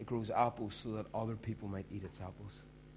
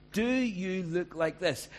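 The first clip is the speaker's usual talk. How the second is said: higher, louder and slow; raised, slow challenge; 7 semitones higher, 6 dB louder, slower than usual. Shown as a demonstration. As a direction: press the question home.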